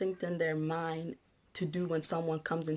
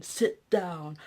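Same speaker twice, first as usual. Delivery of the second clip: louder, airy hissed repeat of sit down; condescending; 6 dB louder than usual. Retold or imitated. Imitating the policeman ordering her mother, louder and airy. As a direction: bark it down at her like a scolding. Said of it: "he was so condescending".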